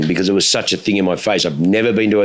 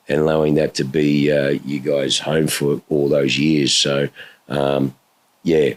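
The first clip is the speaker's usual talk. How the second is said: real sadness; 6 semitones lower, slower than usual. Real, not acted. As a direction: let the voice sink as you mourn. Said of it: "it's very sad".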